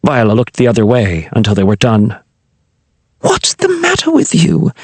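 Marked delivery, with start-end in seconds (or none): 3.2-4.7 sharp yelp, much higher. Acted. Queen snapping at her careless lover, high startled yelp. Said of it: "yelped Hail Meridian".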